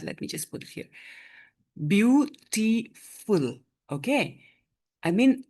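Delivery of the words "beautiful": "Beautiful" broken slowly into three separate beats; syllable by syllable. Shown as a demonstration. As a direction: sound out "Beautiful" one syllable at a time. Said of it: "the pronunciation, when we are pronouncing".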